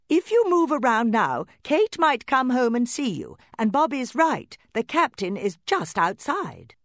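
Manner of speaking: high, loud motherly warning; anxious mother doll. Acted. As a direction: warn her child urgently. Mama doll warns Annabel.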